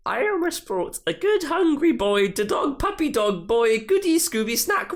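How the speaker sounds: put-on high, squeaky doggy baby talk; silly puppy voice. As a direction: coo it like talking to a puppy. Reading a silly subtitle aloud.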